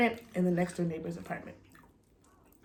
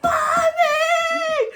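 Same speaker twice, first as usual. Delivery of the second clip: high, loud crying call; child crying out; 8 dB louder than usual. Retold or imitated. Mom imitating daughter shut out, crying shout. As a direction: wail for mommy like a locked-out child. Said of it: "She's like".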